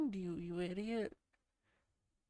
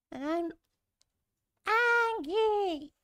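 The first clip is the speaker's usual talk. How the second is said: much higher and louder, hammed-up yell; mock fury; 9 semitones higher, 7 dB louder than usual. Streamer to chat, overplaying anger. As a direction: bellow it in fake rage.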